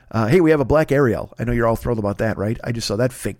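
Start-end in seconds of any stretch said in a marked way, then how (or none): none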